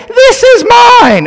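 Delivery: very high, slow tiny squeak of defiance; proud humanity as a squeaking bug; squeaky puny humanity. Pretend. Humanity defies God, very high slow squeak. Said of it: "Humanity in all its might saying".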